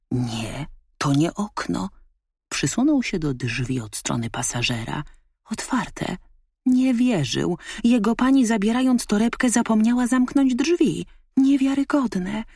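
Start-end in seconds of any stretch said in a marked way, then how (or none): 5.5-12.4 high, loud disbelief; can't believe his luck; astonished dog. A narrator's reading. Dog discovers the unlocked car door.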